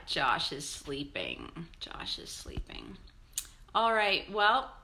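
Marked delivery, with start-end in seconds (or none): 1.8-3.0 same words whispered, softer. Real, not acted. Repeating that her son is asleep, in a whisper. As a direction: hush it as if he could wake.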